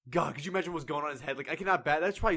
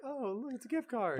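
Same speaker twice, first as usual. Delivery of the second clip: mock cheerful grandparent; 9 semitones higher, 6 dB quieter than usual. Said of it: "they're like".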